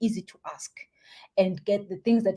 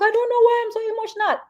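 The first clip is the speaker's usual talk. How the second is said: high, fast imitation of someone welling up; lightly hammed-up emotional outburst, pitched high; mock tearful; 13 semitones higher, 4 dB louder, faster than usual. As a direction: imitate a client bursting into tears. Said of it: "they start crying".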